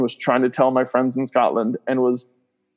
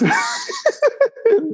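hard laugh. Real, not acted. Laughing while telling funny story.

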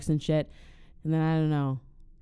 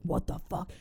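hushed, breathy mock alarm; startled whisper; 7 dB quieter than usual. Plumber hiding under the floor hearing the robbers, alarmed whisper. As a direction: whisper it so nobody hears. Robber hears noise, hides.

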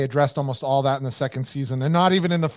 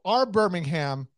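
ordinary talk

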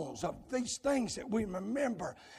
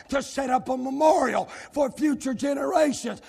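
insistent revival preaching, building louder; driving sermon cadence; impassioned preaching; 6 semitones higher, 8 dB louder than usual. Real, not acted. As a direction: press the burden on the congregation, rising to a shout. Preaches at full force.

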